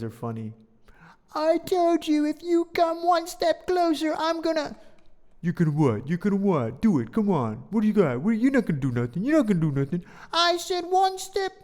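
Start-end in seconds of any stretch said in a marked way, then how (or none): 1.3-4.8 put-on much higher, louder squeaky kid voice; squeaky kid warning the other gang; squeaky threat. Acted kids' standoff. 5.4-10.0 louder mock taunt; teen taunting a rival, louder. Acted kids' standoff. 10.3-11.5 put-on much higher, louder squeaky kid voice; squeaky kid warning the other gang; squeaky threat. Acted kids' standoff.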